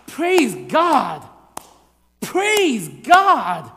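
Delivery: loud, high exclamation of praise; spaced, jubilant preaching cry; praising, celebrating. Shown as a demonstration. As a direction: proclaim it with full celebration. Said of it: "should be a praising and a celebration".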